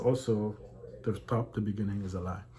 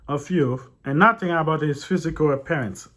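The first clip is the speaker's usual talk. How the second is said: loud, higher read-aloud voice; 7 semitones higher, 11 dB louder than usual. A narrator's reading. Reading a disputed book to viewers, loud read-aloud voice. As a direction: project the text out loud.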